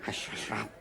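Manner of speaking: urgent hushed warning; hushing. Acted. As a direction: shush him quietly. Urging silence.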